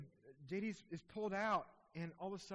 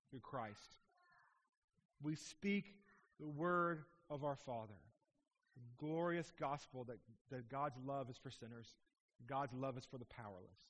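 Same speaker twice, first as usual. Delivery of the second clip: ordinary talk, nothing marked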